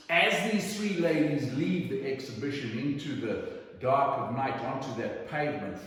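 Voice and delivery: ordinary delivery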